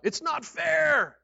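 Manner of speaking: six AM workers protesting their pay, outraged shout; indignant cry. Retold or imitated. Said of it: "who cried out".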